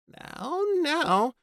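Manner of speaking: very high, nervous soothing; nervous woman placating. A narrator's reading. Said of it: "Babe said nervously".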